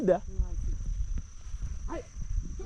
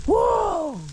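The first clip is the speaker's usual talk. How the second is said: high amazed cry of whoa; awed whoa at the village view; excited whoa; 14 semitones higher than usual. Real, not acted. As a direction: call it out in wonder, much higher.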